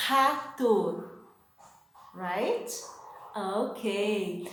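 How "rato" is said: Rato with a throaty h-like R, like hatu; Portuguese R. Shown as a demonstration. Teacher modelling Rato for students to repeat.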